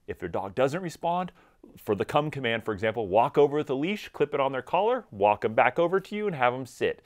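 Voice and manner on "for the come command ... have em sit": usual speaking voice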